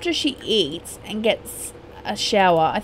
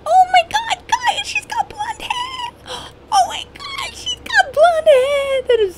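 excited squeal; 3 dB louder than usual. Real, not acted. Sim baby turns blonde.

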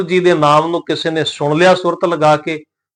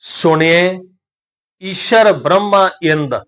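slow, measured recitation of sacred verse; scripture recitation; slower than usual. Shown as a demonstration. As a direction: recite each word drawn out, with pauses.

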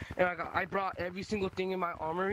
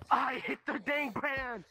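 high slow frustrated wail; frustrated cry; 6 semitones higher, slower than usual. Real, not acted. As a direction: wail at the game. Crash in game, frustrated cry.

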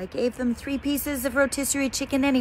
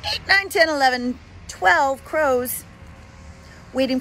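louder, higher excited count; 5 semitones higher, 5 dB louder than usual. Real, not acted. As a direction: count up with rising glee.

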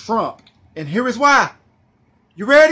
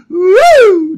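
loud, high whoop; woo; 7 semitones higher, 9 dB louder than usual. Real, not acted. Cheering after his own joke, loud whoop. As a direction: let out a joyful whoop. Celebratory cry.

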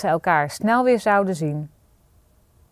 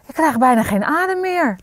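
out of breath, pitched high; high, winded complaint; panting; 7 semitones higher than usual. A narrator's reading. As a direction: gasp it out after running upstairs. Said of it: "hijgde Marie".

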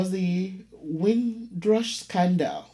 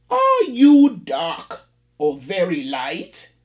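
slow, louder prim landlady voice; landlady, emphasis varied; 3 semitones higher, 7 dB louder, slower than usual. Acted. Landlady rephrasing her question to the caller, slow and louder. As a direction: lean on new words, drawn out and prim. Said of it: "varying the emphasis".

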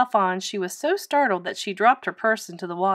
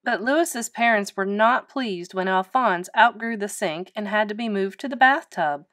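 plain reading voice

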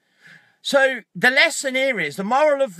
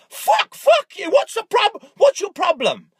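inner rant; 8 semitones higher, 3 dB louder than usual. Retold or imitated. Stuck driver fuming at a car flashing behind him, high angry curse. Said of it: "I'm not saying this. This is all going on in my head".

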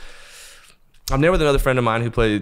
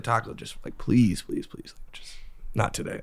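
hushed pleading; 6 dB quieter than usual. Retold or imitated. Security guard pleading quietly.

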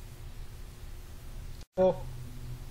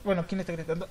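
ordinary talk, nothing marked

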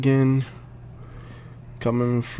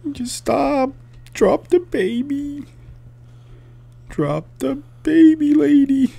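high-pitched urging; 13 semitones higher, 5 dB louder than usual. Real, not acted. Player begs game woman to drop baby, high urging. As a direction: urge her in a high, louder voice.

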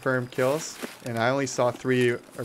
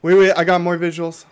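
urgent callout; 5 semitones higher, 11 dB louder than usual. Real, not acted. Spotting new enemies.

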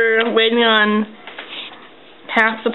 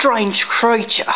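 slow, drawn-out fake Australian; bad Australian accent; slower than usual. Naming the creature for viewers, bad Australian accent. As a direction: stretch it like a mock Australian wildlife host. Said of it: "Your Australian accent is horrible".

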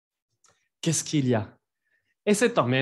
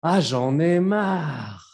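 slow, louder, exasperated "Ah, j'en ai marre."; fed-up intonation; 4 dB louder, much slower than usual. Shown as a demonstration. Teacher demonstrating a fed-up j'en ai marre. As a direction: sigh it out like you're sick of it all. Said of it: "es importante ponerle la entonación".

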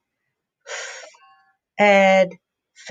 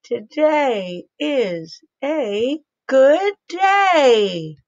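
slow, stretched and louder, higher; louder, excited read; 5 semitones higher, 3 dB louder, much slower than usual. Shown as a demonstration. Teacher reading the sentence to kids, louder and slow. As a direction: read it out brightly and loud. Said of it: "Say it a little louder".

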